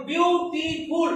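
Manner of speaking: "Beautiful" slowly in three beats, বিউ, টি, ফুল; syllable by syllable. Shown as a demonstration. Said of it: "আমরা যদি syllable গুলো লক্ষ্য করি".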